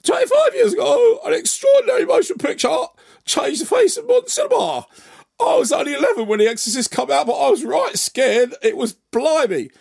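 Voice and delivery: loud, high laddish cockney shout; Danny Dyer. Acted. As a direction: send up your own presenting voice as an over-the-top geezer. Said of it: "I do sound like my own impression of Danny Dyer".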